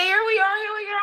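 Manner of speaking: calling out. Retold or imitated. Calling out to the husband.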